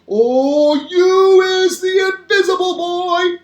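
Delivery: high, loud, drawn-out amazement; squiffy with excitement. A narrator's reading. Daddy discovering the invisible boy, hugely excited. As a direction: swell slowly into an excited high cry. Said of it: "squiffy with excitement".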